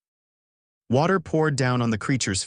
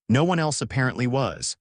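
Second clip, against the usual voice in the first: usual speaking voice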